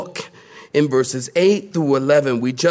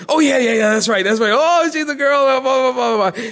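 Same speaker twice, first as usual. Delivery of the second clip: flustered dad; 9 semitones higher, 3 dB louder than usual. Retold or imitated. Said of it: "I went".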